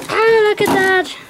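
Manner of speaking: real excited marvelling; thrilled. Real, not acted. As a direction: squeal in delight and slowly gush. Admiring the trailer just unpacked.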